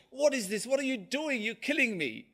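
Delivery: high-pitched retold cry of protest; mock scream. Customer pricked by the barber's needle, mock screaming. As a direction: act out a panicked outcry, pitched high. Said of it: "he screams his head off".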